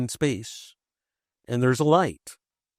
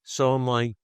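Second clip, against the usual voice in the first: ordinary delivery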